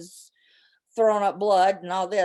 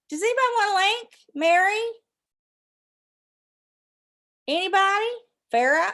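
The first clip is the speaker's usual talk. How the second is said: calling out; 10 semitones higher, 5 dB louder, faster than usual. Real, not acted. Calling out to chat viewers.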